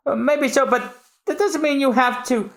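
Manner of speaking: high, pleading objection; protesting apprentice. Acted.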